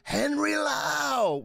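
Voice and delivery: high, slow announcer intro; stretched-out stage announcement; announcer call. Retold or imitated. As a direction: call him out like a hype man. Announcing a name at a show.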